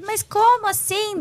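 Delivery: high-pitched incredulous question; re-enacted "how come?" to God; retold bafflement. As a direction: protest in disbelief, pitch jumping up.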